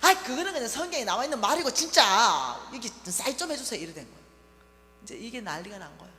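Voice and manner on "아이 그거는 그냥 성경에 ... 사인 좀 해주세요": irritated shout. Retold or imitated. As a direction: push the papers at mother, fed up. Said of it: "짜증이 올라와요".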